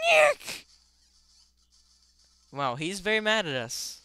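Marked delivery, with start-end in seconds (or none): 0.1-0.7 very high, loud squawk; screaming boss cry. Retold or imitated. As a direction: shriek it like a game boss. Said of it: "he's screaming".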